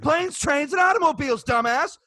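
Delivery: high-pitched, exasperated mock insistence; mock indignant repeat. Server parodying the rude customer insisting, mock indignant.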